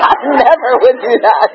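laughing through the words; real burst of laughter, voice breaking up. Real, not acted. Laughing at her own joke.

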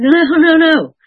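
high, loud, outraged denial; indignant protest. Retold or imitated. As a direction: shoot it down in disbelief. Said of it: "I said".

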